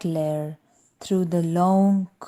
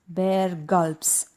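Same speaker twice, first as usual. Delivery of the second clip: ordinary delivery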